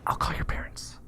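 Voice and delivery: hushed, unvoiced aside; secretary's confidential whisper. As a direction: whisper it discreetly to the kid. Secretary quietly offers to boy.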